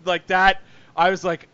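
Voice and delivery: ordinary talk, nothing marked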